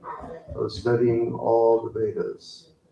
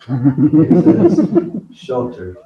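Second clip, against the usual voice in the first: laughing; 2 semitones higher, 9 dB louder, slower than usual. Real, not acted. Teacher laughs mid-answer.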